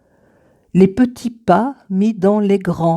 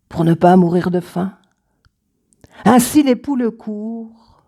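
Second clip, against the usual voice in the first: ordinary talk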